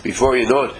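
ordinary talk

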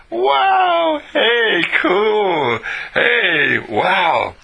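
high, drawn-out wow of delight; delighted amazement. Acted. Speaker models being incredulous at good things, delighted amazement.

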